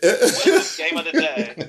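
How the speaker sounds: slow, high, giggling question; laughing through a tipsy question. Real, not acted.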